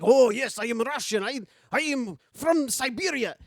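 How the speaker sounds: proud father boasting he is Russian; mock Russian accent. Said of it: "the father's like".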